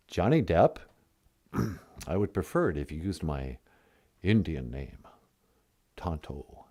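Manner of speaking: a mock-solemn caller named Tonto; low solemn Tonto voice.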